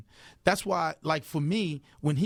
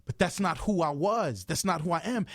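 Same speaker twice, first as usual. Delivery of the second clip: normal talking voice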